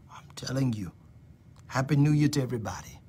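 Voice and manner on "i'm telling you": whispered assurance, fading; prophetic whisper. Real, not acted. Preacher whispering assurance to Michelle Wood. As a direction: lean in and whisper it.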